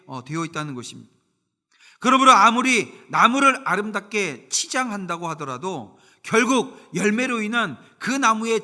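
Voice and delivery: loud, rising sermon delivery with emphatic pauses; fervent preaching. Acted. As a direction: preach it out to the congregation. Preacher at full force. (힘 있는 설교조)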